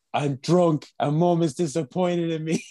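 high, slow tearful wail, hammed up; imitating a drunken crying confession; mock bawling. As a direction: wail it like a weeping drunk teenager. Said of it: "bawling, crying".

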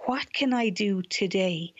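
usual speaking voice

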